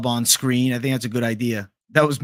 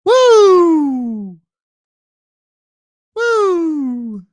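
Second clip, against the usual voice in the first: very high, loud woo; Finchman impression; 14 semitones higher, 10 dB louder than usual. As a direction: let out a huge high woo. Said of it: "Finchman impression".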